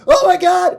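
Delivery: high, loud shout of horror; hammed-up panic; terrified cry. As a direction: cry out frozen in fear. Frozen victim.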